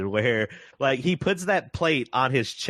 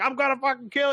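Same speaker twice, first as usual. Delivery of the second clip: much higher, gloating robot threat; gleeful killer robot; 12 semitones higher, faster than usual. Retold or imitated. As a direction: squeal it like a giddy killer robot. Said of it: "He's like".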